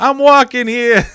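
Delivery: put-on high, loud New York accent shout, slowed down; New Yorker yell. Crosswalk, yelling at a honking car in a New York accent. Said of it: "I gave him the".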